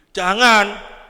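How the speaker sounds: loud forbidding call; a loud, forbidding "no" against temptation; sharp loud no. Real, not acted. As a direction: cut it off hard. Warning against the devil.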